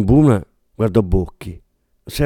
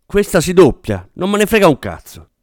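higher, brusque dismissal; impatient snap; 7 semitones higher, 3 dB louder than usual. A narrator's reading. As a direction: cut the objection short, irritated. Director snapping at the sound man. (secco e spazientito, tono acuto)

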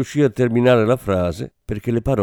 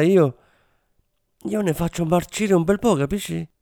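bitter rising rant, higher; a cornered man spitting his hatred; lightly voiced drug lord's tirade; hateful drug lord; 7 semitones higher than usual. A narrator's reading. Drug lord answers killer.

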